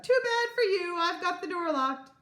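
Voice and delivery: high, loud gloating call; childish jeer through a locked door; rude taunt. A narrator's reading. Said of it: "laughed rudely and called out".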